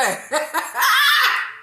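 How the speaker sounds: high burst of laughter. Real, not acted. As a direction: let a squealing laugh out. Laughing at herself.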